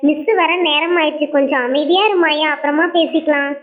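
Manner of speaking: ordinary talk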